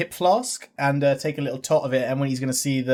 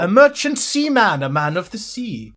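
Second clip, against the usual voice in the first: slow, proud, louder Welsh sailor; old-world Welsh accent; 4 semitones higher, 4 dB louder, slower than usual. Acted. Welsh seaman proudly stating his trade, slow Welsh accent. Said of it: "sounds like he's coming from another century".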